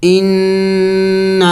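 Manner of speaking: ان with the doubled نون hummed through the nose; ان ringing in the nose; nasal ghunna. Shown as a demonstration. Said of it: "میں نے ناک میں آواز پیدا کی".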